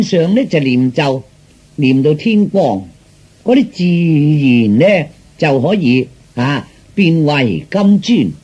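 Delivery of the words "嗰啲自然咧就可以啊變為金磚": plain reading voice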